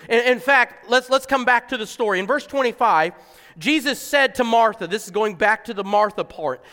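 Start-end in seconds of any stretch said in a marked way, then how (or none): none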